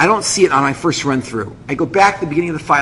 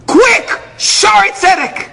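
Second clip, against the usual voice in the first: high, loud order; urgent shout; 13 semitones higher, 3 dB louder, much slower than usual. Retold or imitated. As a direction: shout the destination to a driver. Shouting destination at taxi driver.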